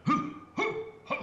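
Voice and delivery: sharp breathy karate grunts; high breathy fighting grunts; karate shouts. Retold or imitated. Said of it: "erano i colpi di karate".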